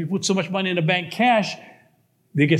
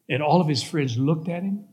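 ordinary delivery

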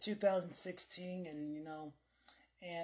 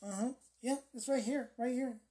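higher-pitched imitation of someone finding it; listeners looking it up; 5 semitones higher than usual. Listeners looking up the video right now, mock matter-of-fact discovery. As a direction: play a listener pointing out a search result. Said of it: "and they're like".

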